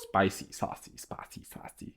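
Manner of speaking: very soft, voiceless whisper; teasing whisper. Retold or imitated. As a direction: whisper it like juicy gossip. Teasing reaction to racy story.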